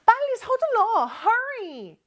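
hurrying mom. Acted. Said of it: "엄마가 또 말씀하시네요".

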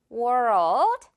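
world, slow, R curling into L. Shown as a demonstration. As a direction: stretch world, tongue lifting to L. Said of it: "선생님의 혀 느껴지나요". (world를 천천히 굴려서)

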